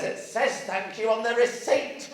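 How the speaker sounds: high-pitched imitated retort; retold comeback. Checkout woman answering an annoyed customer, high mock retort. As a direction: act out the checkout woman's punchline, pitched right up.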